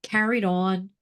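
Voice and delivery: usual speaking voice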